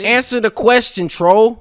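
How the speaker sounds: yelled high and loud at the guest; loud demand. Real, not acted. Streamer yelling at the troll to answer, loud demand. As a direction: bark the order across the call.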